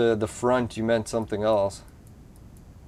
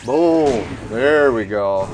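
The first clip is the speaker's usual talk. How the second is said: loud, punchy celebration of a hit; loud victorious exclamation; excited cheer; 6 dB louder, much slower than usual. Real, not acted. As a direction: call it out loud and drawn, pleased.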